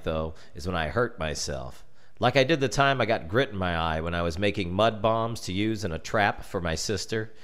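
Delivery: plain reading voice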